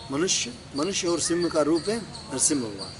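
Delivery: ordinary talk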